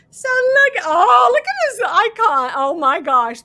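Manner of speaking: high, loud cry of delight; delighted squeal. Real, not acted. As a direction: gush over something adorable. Delighted at a cute icon.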